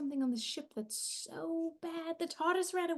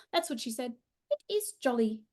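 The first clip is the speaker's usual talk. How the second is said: usual speaking voice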